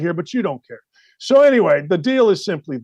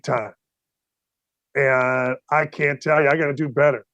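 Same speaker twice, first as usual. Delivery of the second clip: lower, earnest player owning his failure; sincere apology to teammates; 6 semitones lower than usual. Pretend. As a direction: confess to the team, low and sincere. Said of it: "go to my teammates and go".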